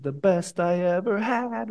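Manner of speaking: slow, drawn-out sung-shout of the chorus line; a slow, held imitation of the singer shouting "The best I ever had"; mock shout of the lyric. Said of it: "Then he shouts it".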